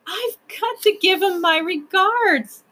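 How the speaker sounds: put-on high, slow tearful child. A narrator's reading. Lindy pleading with the proc, tearful. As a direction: plead it high and drawn out, close to tears. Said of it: "added Lindy tearfully".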